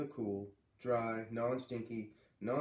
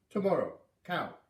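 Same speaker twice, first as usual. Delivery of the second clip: put-on odd, louder old-lady voice; old Missus Falala; 4 semitones higher, 5 dB louder than usual. Old neighbor telling the kids to return, odd and loud. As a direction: toss it out as a final command.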